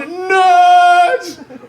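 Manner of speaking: high, loud, stretched-out jeer; mock bully yell. Bully after hitting boy with rock, triumphant yell. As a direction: bellow one long taunting word.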